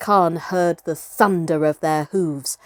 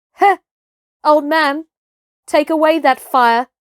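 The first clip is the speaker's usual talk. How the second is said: higher, louder sharp order; angry command; 7 semitones higher, 6 dB louder than usual. Acted. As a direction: snap the order at the old hunter. Mowgli orders the hunter off.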